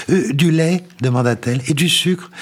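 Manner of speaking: fussing landlady. A narrator's reading. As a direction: fuss over the tea. La logeuse sert le thé. (petites questions d'hôtesse, voix très aiguë)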